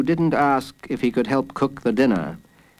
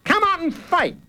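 put-on high-pitched boy's challenge; high, put-on holler; playacting shout. A narrator's reading. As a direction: shout like a kid playing bandit. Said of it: "he shouted".

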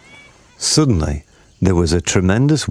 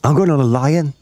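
put-on high eager kid voice; excited child's cry; 8 semitones higher, 3 dB louder than usual. A narrator's reading. Third child claiming the lion, high excited cry. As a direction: call it out high, a keen child. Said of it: "cried a third one".

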